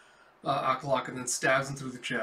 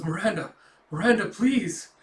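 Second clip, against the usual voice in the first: high, loud begging; desperate begging; 7 semitones higher, 7 dB louder than usual. Acted. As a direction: beg her twice, louder. Governor begging sister again.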